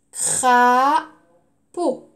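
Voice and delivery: "Crapaud" loud, long craaa, then pau; syllable by syllable. Shown as a demonstration. Teacher repeats for pupils, loudly split into syllables. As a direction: hold the first syllable of "Crapaud", pause, finish. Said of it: "Je redis".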